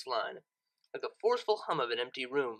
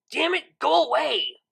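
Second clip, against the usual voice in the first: loud, high and slow, pushing the thoughts away; frustrated yell; 10 semitones higher, 10 dB louder, slower than usual. Acted. DJ alone in her room yelling at her own thoughts, loud and high. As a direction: bellow it out in raw frustration. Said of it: "she yelled".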